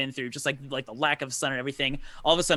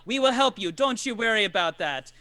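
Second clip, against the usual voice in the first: higher-pitched, eager character voice; Jarek, obliging host; 7 semitones higher, 4 dB louder than usual. Acted. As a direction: insist on helping the travellers. Game master voices Jarek reassuring.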